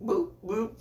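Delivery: high, giggly boop boop through a laugh; playful boop noises. Acted. Teasing his little brother with the goat head, giggling high. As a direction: tease a little kid, laughing as you say it.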